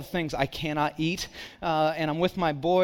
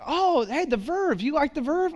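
higher, bright mock lady voice; chirpy lady; 9 semitones higher than usual. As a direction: play an eager lady at a sale. Lady at estate sale voiced.

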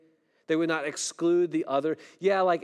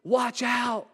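retold shout; 8 semitones higher than usual. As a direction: shout the warning as the car swerves. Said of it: "Dad yells out".

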